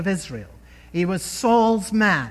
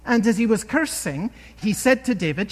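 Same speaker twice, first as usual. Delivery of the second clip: normal talking voice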